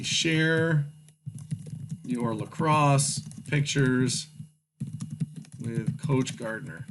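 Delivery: slowly reading out while typing; slow dictation. Shown as a demonstration. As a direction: spell it out word by word.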